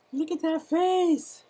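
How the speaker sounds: high, slow coo of "Look at that face" to the turtle; cooing baby talk. Shown as a demonstration. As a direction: gush over the little turtle like talking to a baby.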